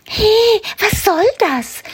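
very high, indignant rabbit protest; a little rabbit complaining sharply; rabbit calling out. Acted. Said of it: "möchte Karl-Heinz rufen". (hoher, empörter Kaninchenruf)

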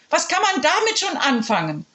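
high, haughty headmistress scoff; scoffing principal. Acted. Principal scoffing at the children, high and haughty. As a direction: sneer at the idea.

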